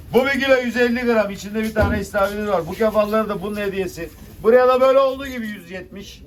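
loud auction call; auctioneer. Real, not acted. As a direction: call the lot to the room. Fish auction bidding call.

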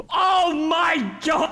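high, slow, stretched-out shriek of disbelief; shocked scream. Real, not acted. Gamer screaming at a shock pull, shrieking.

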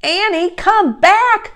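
high, loud, slowed call; shout. A narrator's reading. Jack shouting as Annie runs downhill, loud drawn-out shout. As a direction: shout after someone running off. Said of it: "Jack shouted".

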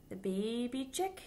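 ordinary delivery

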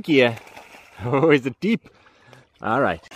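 usual speaking voice

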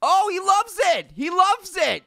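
very high, drawn-out delight; excited cheer. Pretend. Player thrilled the Yeti likes noodles, excited high cheer.

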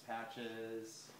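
usual speaking voice

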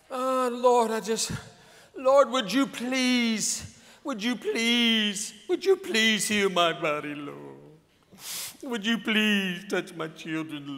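lightly mock a faithless beggar at prayer; begging prayer. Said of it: "Get some faith in your voice".

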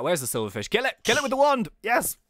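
normal talking voice

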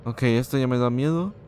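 ordinary talk

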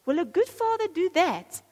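high, loud, sneering accuser; accusing inner voice. Acted. Preacher voicing the accuser to parents, sneering and loud. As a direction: jab at them like a scornful critic. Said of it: "Do you know the voice that says".

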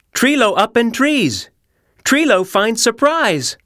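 put-on high little lemur baby talk; Treelo's voice. Treelo telling Bear about his find, high and excited. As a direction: chatter like an excited toddler.

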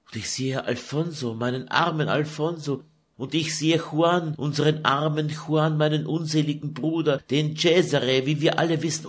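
put-on a tired young woman pouring out her grief; Lucretia lamenting. Daughter pouring out her sorrow to her mother, weary.

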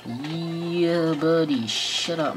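usual speaking voice

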